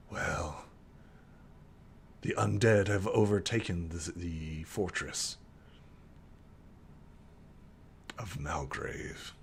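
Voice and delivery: low, soft and halting, fading almost to a whisper; grave, hushed revelation. Acted. Character reveals the undead took the fortress, hushed and grave. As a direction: break bad news slowly and quietly, with long pauses. Grave news broken to the visitors.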